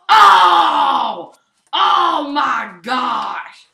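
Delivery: loud, drawn-out, slow gasp of a yell; real amazement, very loud; stunned shout. Real, not acted. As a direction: let the shock stretch out. Big hit in match.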